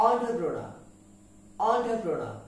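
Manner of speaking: entrepreneur as on-tro-pro-na; correct pronunciation. Shown as a demonstration. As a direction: say entrepreneur with an ON start. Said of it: "কি হবে?".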